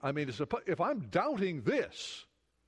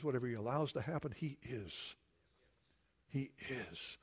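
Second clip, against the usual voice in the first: hushed; 5 semitones lower, 8 dB quieter than usual. Real, not acted. Hushed repeated affirmation.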